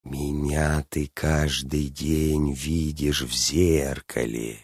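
slow, low, hushed dragon voice; crafty dragon drawing out every word; sly lisping dragon. A narrator's reading. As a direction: speak softly and at half speed, as a cunning dragon. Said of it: "хитро шепелявым голосом выговорил дракон".

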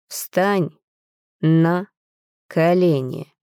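measured command, word by word; cold direct order. A narrator's reading. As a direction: order it coldly, a pause after each word. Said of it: "Теперь в нём слышался прямой приказ".

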